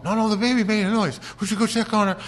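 high, loud imitation of his wife; wife to husband about the baby, high and insistent. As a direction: mimic the wife, sure of herself. Wife insists baby made noise.

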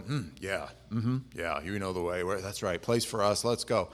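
low, mumbly nodding-along agreement; feigned understanding. Acted. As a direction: pretend you get it, agreeing vaguely. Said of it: "all of the other disciples are going".

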